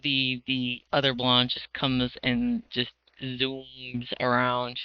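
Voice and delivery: ordinary talk, nothing marked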